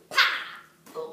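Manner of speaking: sharp breathy slap noise; a high, loud, voiceless slap; slap sound. Acted. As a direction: smack it out with the lips. Slap sound effect in story.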